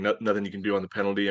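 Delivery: ordinary talk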